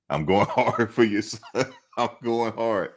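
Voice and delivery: laughing through the words. Real, not acted. Fan laughing at his own shout to Monica. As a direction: crack up while repeating it.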